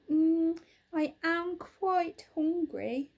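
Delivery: slow hungry bear. Acted. Little Bear at the picnic, slow and savouring. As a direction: relish the thought of food.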